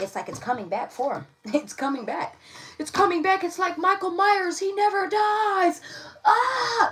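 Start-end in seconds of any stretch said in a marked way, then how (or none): 1.5-5.8 rising, louder and higher into a horror-movie thrill; excited spooky build. Acted. Teasing viewers about a past that never dies, louder and higher. Spooky Michael Myers joke. 6.2-6.9 high playful scream; mock horror scream. Horror-movie joke for viewers, a high playful scream.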